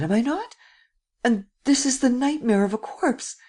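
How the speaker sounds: high, drawn-out anguished begging; frightened entreaty, near breaking down; terrified pleading. Acted. Terrified lad pleads with the prince.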